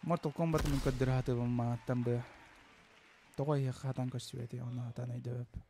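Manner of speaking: usual speaking voice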